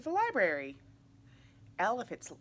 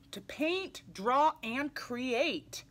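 mild excitement; 5 semitones higher, 4 dB louder, much slower than usual. Acted. Reading the art room page with gusto.

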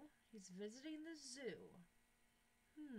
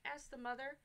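put-on reading to children; 4 dB louder than usual. A narrator's reading. Teacher reads story aloud.

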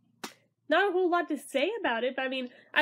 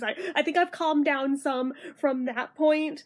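ordinary talk

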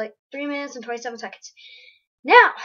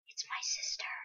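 soft unvoiced whisper; 13 dB quieter than usual. Player spotting the girl panda, secret whisper.

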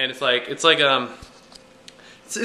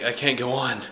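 mock dramatic despair; 3 semitones lower, 5 dB quieter than usual. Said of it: "I'm so dramatic".

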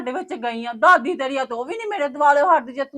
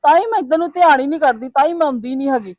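loud, hurt, raised voice; heated quarrel; 7 dB louder than usual. Acted. Daughter loudly tells mother she'll stay away. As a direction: fire it back at her.

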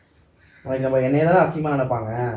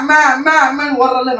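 high, loud mock of a girl crying for mum; mocking whine; 9 semitones higher, 6 dB louder than usual. Husband mocks wife running to her mother, whiny and high. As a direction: whine like a child running home.

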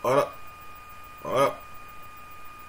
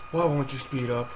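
ordinary delivery

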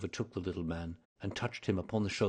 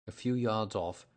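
ordinary delivery, nothing marked

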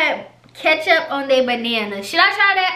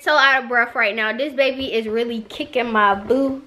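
normal talking voice

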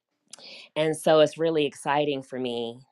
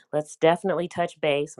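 ordinary talk

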